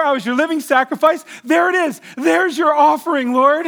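high, loud, excited pointing out; overjoyed showing off. Pretend. Imagined joyful report to the Lord.

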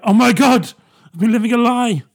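a sudden loud mock revelation; mock shock. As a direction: cry out like your world just fell apart. Mock realisation about Santa.

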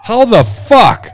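loud, slow, incredulous; disbelieving shout. Real, not acted.

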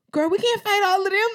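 very high, fast, loud retold plea; mock panicked protest. Big sister holding back little sister, high mock protest. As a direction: squeal it in comic disbelief.

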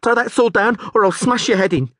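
high, loud, shaky threat; trembling yell. Acted. Scared guard threatens the Rivian, trembling yell. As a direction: yell through fear. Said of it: "yelled the trembling guard".

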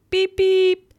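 high, loud honking beep; imitated car horn. Car honking at the moving cones, loud beeps.

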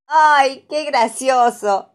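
loud, high, slowed delight; exclaiming. A narrator's reading. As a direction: burst out laughing at the picture. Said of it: "exclama Pepa".

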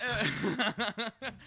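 high-pitched burst of laughter; helpless high giggle. Real, not acted. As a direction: crack up at the jab. Laughs at the teasing retort.

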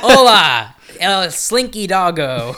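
slow, drawn-out Spanish ride announcer; Spanish announcer accent. Retold or imitated. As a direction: announce it like a Spanish theme park voice. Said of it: "the Spanish voice comes on".

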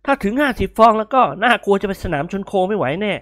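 grinning objection; amused young man. A narrator's reading. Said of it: "นิกรอมยิ้ม".

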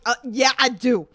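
loud, indignant comeback; indignant retort. Retold or imitated. Retelling a defence of her accent, loud indignant retort.

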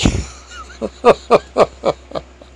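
loud high burst of laughter; real loud laugh; big high laugh. Real, not acted. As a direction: really crack up, loud and high. Laughs at own story.

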